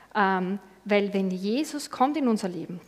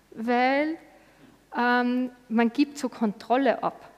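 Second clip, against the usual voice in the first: ordinary talk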